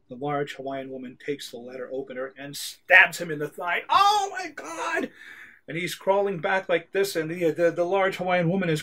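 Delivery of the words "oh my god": slow, high, drawn-out cry of pain. Acted. Agent stabbed by the letter opener, slow high cry. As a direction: stretch out a high wail of shock.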